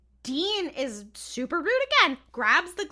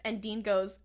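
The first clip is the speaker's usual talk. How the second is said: ordinary talk, nothing marked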